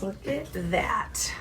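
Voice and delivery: slow whisper; hushed wonder. Real, not acted. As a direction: whisper it slowly, drawing the listener in. Marveling at the dream picture.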